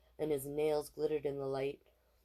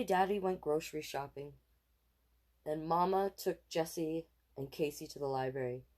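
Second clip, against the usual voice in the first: ordinary delivery, nothing marked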